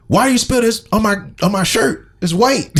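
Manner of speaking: high, whiny complaint about the shirt; hammed-up high-pitched whining; mock whiny complaint. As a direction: whine like someone fussy about a stain.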